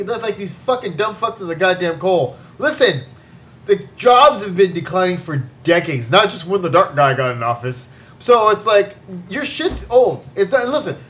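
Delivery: ordinary delivery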